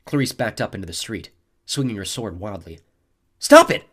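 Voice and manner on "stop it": loud high shout; boy ordering the bully to stop. A narrator's reading. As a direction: yell it at him. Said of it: "I told Phobos".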